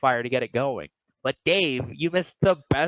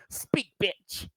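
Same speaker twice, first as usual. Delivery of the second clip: softer, higher mean jab; mean retort; 6 semitones higher, 5 dB quieter than usual. Retold or imitated. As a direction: toss off a cruel little line. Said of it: "That sounded so mean".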